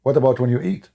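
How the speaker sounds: ordinary delivery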